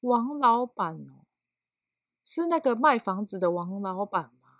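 very slow, drawn-out mock reply with long pauses; imitating slow coworker. 模仿同事极慢地回答. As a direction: coworker asked for a file, mimicked dragging each word out slowly. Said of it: "就这么慢哦".

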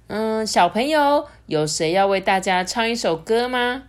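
usual speaking voice

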